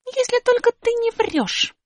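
высоко и медленно, с недоверием; оборви усатого; резко оборвать. Acted. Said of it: "оборвала его Аля". (резкий высокий ответ Али)

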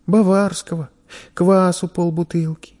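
put-on high child's voice; meek young boy. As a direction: list it timidly for the merchant. Boy lists purchases.

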